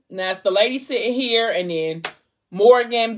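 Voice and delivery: ordinary delivery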